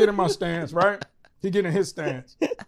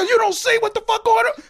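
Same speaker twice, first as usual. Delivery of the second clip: retold disbelief; 12 semitones higher than usual. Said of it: "I go".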